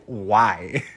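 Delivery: cracking up, voice squeaking high; high cackling laugh. Real, not acted. Recapper cracking up at the absurd line.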